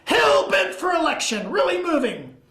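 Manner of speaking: high, acted-out chase account; excited retelling. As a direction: play the officer reliving the chase. Acting out detective's quoted chase account.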